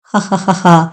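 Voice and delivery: a sarcastic, eerie cackle; mocking laugh. A narrator's reading. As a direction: laugh at him with scorn. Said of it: "వ్యంగ్యంగా నవ్వసాగింది".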